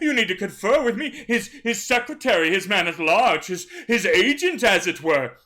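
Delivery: flustered butler guarding his master; fussy servant voice. Acted. Servant stopping the captain at the tent, fussy and flustered.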